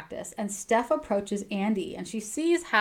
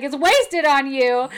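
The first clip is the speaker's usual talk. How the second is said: teasing; 4 semitones higher, 8 dB louder, slower than usual. Retold or imitated. Andy teasing about the bathtub.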